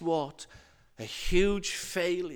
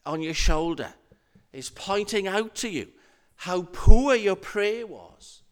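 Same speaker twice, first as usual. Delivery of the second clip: ordinary talk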